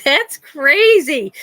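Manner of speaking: slow, drawn-out disbelief; drawling astonishment, rising in pitch; incredulous. Retold or imitated. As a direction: stretch each word out, appalled and amused. Incredulous reaction to old stories.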